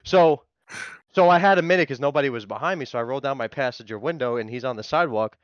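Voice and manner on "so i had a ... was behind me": ordinary talk, nothing marked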